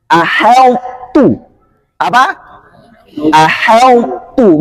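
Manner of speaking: slow "Aheltu" with thin light h. Shown as a demonstration. Teacher models the correct thin reading. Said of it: "harus tipis ya".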